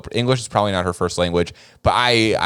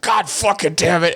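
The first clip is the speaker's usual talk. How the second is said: raised, mock furious curse; angry outburst; 9 semitones higher than usual. Friend caught peeing, cursing in mock anger. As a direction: blurt it like a friend fed up and thwarted. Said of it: "he got so mad".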